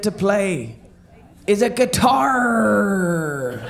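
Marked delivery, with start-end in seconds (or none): 1.5-3.6 slow hammy pirate yell; pirate punchline. Acted.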